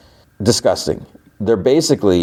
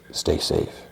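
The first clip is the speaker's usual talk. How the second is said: whispered softly under the breath; whispered retold farewell; quiet whisper; 9 dB quieter than usual. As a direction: whisper it like a secret goodbye. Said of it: "he quietly says".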